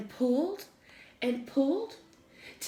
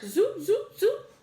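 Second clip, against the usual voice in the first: rising, high zoop sounds; zoop sound effect; 9 semitones higher, slower than usual. A narrator's reading. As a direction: zip it up with high pops.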